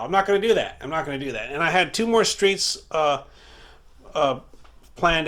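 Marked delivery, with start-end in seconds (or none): 0.0-0.8 emphatic. Real, not acted. Repeats refusal emphatically.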